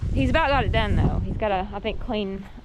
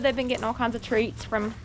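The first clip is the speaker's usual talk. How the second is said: ordinary talk, nothing marked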